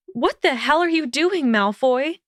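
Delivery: high, startled outburst; breathless startled cry; startled panting. A narrator's reading. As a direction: blurt it out, caught off guard. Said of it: "Hermione panted".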